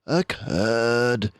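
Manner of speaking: slow, gentle reassurance; calm her down kindly and slowly; a detective soothing an upset mousekeeper, kindly and slow. A narrator's reading. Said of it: "said Basil kindly".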